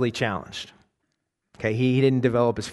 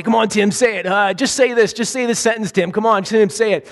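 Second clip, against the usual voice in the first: loud, higher mock taunting from the back of the bus; taunting teens; 7 semitones higher, 4 dB louder than usual. Kids at back of bus egging Tim on, loud taunting. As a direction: goad him like bullying cool kids. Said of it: "trying to egg him on, say".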